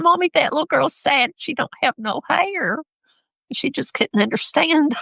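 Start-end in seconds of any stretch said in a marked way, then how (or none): 0.0-2.8 put-on child's voice. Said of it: "she said".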